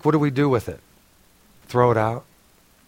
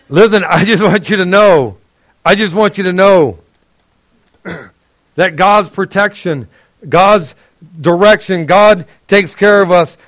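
raised, louder preaching voice; higher, louder full-force preaching; 5 semitones higher, 4 dB louder than usual. Acted. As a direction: build the promises like a preacher. Preaching builds.

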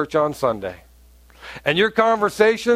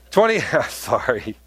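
laughing at himself; 5 semitones lower than usual. Real, not acted. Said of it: "I'm getting a little excited".

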